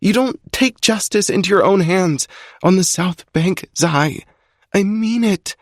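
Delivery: high female voice, pleading; girlfriend pleading. A narrator's reading. Alex begging Zai to stop, high female plea.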